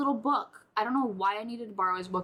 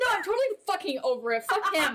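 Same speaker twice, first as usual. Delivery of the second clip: laugh-shout the denial; 7 semitones higher than usual. Real, not acted. Host laughs and yells sarcastically that she is over it.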